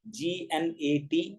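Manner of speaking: letter by letter, slow and soft; spelling out slowly. Shown as a demonstration. Teacher spelling the word for the class, slowly. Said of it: "इसी तरह".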